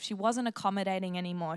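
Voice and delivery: ordinary talk, nothing marked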